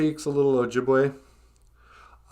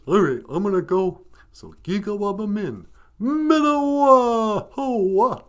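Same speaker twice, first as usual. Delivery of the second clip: loud, goofy dog goodbye; Benjamin; 5 semitones higher, 4 dB louder than usual. Pretend. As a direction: sign off big, stretching the last words high. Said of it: "you know, goofy of the two".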